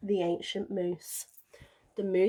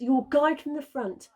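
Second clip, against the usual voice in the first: advising; 6 semitones higher, 6 dB louder than usual. A narrator's reading. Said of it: "they advised".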